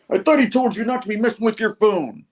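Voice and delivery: high-pitched, hammed-up telling-off; mock scolding. Retelling flunky telling off the security guard, mock indignant. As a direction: scold him in a high put-on voice.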